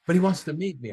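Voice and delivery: normal talking voice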